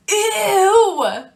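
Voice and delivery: loud high ew of disgust. Real, not acted. Teacher to the class about unwashed hands, loud high disgusted ew. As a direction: recoil at the dirty hands.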